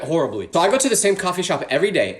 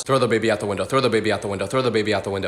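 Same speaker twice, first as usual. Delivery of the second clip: low, monotone repeated chant; intrusive thought; 6 semitones lower, faster than usual. Acted. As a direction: drone it like a compulsive inner voice.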